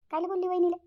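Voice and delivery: a son demanding why his mother scolded his wife, loud and upset; upset outburst. Acted. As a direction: confront her sharply.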